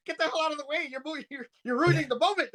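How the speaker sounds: hammed-up get out of the way; mock annoyed yell. S0 retelling yelling at the wrestler to move. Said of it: "I was like".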